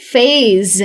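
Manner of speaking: फेज with a buzzing voiced z, not s; voiced z sound. Shown as a demonstration. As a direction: say फेज ending on a clear buzzing z. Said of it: "इसलिए इसे कहेंगे".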